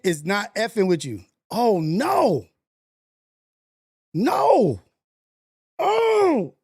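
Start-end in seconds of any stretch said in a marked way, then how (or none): 1.5-6.6 rising, louder cries of "no"; real gaming despair building up; anguished groan. Real, not acted. Reacting to a loss on stream.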